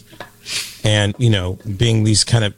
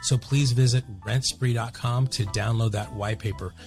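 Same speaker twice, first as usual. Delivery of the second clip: soft, even sponsor read; 10 dB quieter than usual. Acted. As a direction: read the sponsor spot smoothly. Sponsor ad read over music.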